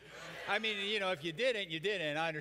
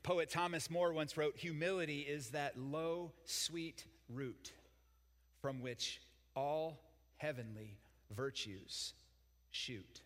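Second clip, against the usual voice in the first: recited quotation; slower than usual. Acted. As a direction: savour it like a line of poetry.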